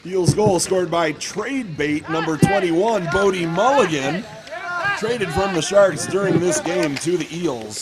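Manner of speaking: loud, slow scorer announcement; hockey announcer. Real, not acted. Rink announcer naming the traded player who scored, announcing. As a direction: project each name to the whole rink.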